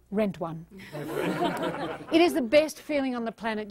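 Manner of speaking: normal talking voice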